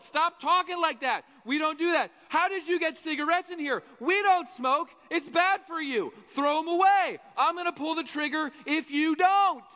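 high, loud string of rebukes; controlling guard's scolding. Acted. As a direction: rattle off orders and threats from the tower. Tower guard Jesus scolds prisoners.

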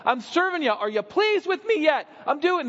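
high, loud, desperate pleading; frantic illegitimate son crying for approval; frantic striver. Acted. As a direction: beg for God's notice, frantic. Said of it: "running around frantic saying".

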